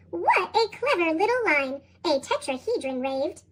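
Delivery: ordinary delivery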